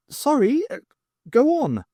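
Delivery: embarrassed little squeak; flustered dwarf. A narrator's reading. Dwarf apologises to the crowd, flustered and high.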